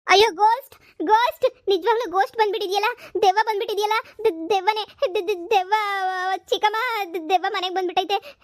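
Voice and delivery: panicked cry to her aunt at seeing a ghost; terrified shriek. Acted. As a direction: scream it out as the ghost appears. Girl sees the ghost arrive.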